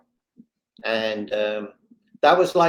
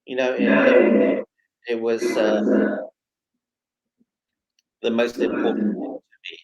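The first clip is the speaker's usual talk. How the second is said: voice catching; 4 dB louder than usual. Real, not acted. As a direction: fight back the tears as you say it. Moved recalling wife's support.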